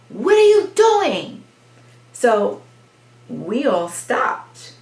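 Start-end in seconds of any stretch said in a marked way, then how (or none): 0.1-1.4 loud, high, drawn-out yell; imitated yell. Neighbor yelling at passing children, loud imitated yell. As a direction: yell it slowly like an angry neighbor. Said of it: "she yelled".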